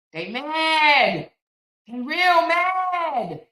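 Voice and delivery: slow, drawn-out shout; emphatic shout. Real, not acted. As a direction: stretch it out and belt it. Emphatic preaching to viewers.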